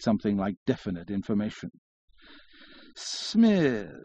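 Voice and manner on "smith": high, long-drawn single syllable; drawling syllables. A narrator's reading. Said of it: "well-known peculiar way of drawling out his syllables".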